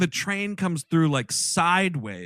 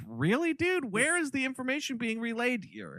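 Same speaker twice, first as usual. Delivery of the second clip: much higher, softer, mock disbelief; a hammed-up, baffled question; incredulous; 10 semitones higher, 5 dB quieter than usual. Viewer mocking the newscaster's info, high mock disbelief. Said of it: "And I'm like".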